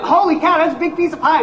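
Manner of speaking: high, amazed gasp of an audience at a huge pie; mock crowd gasp, pitched high; mock amazement. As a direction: play the crowd gawking in wonder. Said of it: "And you all went".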